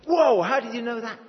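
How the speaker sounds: high, loud startled exclamation. Pretend. A caught-out scribe reacting in loud surprise. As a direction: blurt it out like you've been caught.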